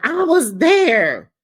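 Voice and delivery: high, loud, slow tearful protest; mimicking Heather crying out. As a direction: plead it through tears. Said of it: "Heather is crying".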